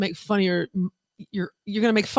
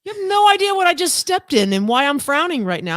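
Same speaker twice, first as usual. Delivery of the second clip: mock angry retort; 9 semitones higher than usual.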